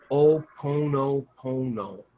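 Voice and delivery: Oponopono slowly, syllable by syllable; Oponopono sounded out. Shown as a demonstration. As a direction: draw out every O in Oponopono for learners. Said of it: "teach them how to say it".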